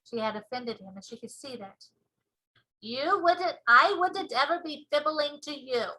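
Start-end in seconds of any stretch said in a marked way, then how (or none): 2.8-5.9 slow, loud proud giant; BFG giant voice. Acted. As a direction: show off the ears proudly. BFG hurt.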